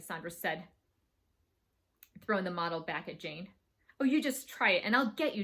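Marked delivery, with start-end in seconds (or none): 4.0-5.4 loud, daring challenge; yelled threat. A narrator's reading. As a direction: dare her, voice raised. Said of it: "Jane yelled".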